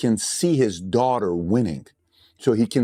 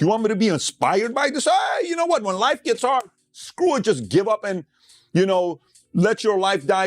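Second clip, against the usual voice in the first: much higher, mocking the movie's message with a sudden shout; sarcastic rant, pitched way up; sarcastic mockery; 10 semitones higher than usual. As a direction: scoff at it in a high, sneering shout. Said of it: "I'm sitting like".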